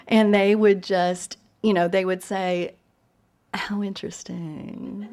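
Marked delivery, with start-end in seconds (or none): 3.5-5.1 slow, whispered mock politeness; imitating an appalled, hushed response; polite hushed reaction. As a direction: whisper it slowly, as a strained courtesy. Voices the polite, appalled Bostonians.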